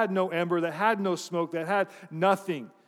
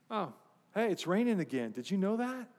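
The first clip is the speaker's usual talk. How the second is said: soft, lilting change of subject; casual deflection; 3 semitones higher, 5 dB quieter than usual. Acted. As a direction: brush it off with innocent small talk, voice rising at the end. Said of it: "Just deflect and go somewhere else".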